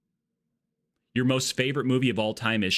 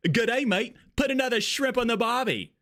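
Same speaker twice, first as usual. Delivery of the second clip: very high, hammed-up Australian accent; mock Australian accent; 12 semitones higher than usual. Sorority sister answering where she's from, mock Australian accent. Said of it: "I really butchered up that accent".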